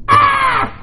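high sudden yell; startled shout. Pretend. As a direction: cry out as if startled. Mock shout at roof torn off.

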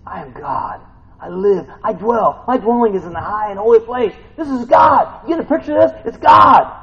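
high, shouted proclamation of God's majesty; full-force preaching. Acted. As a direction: build to a thundering, high-pitched shout.

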